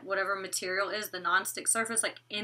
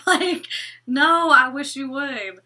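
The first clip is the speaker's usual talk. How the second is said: sassy dare; 4 semitones higher, 7 dB louder, slower than usual. Acted. Daring imagined water sprayer.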